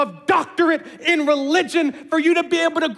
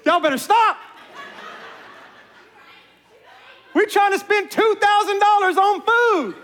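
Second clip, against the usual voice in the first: laughing rebuke; 8 semitones higher than usual. Real, not acted.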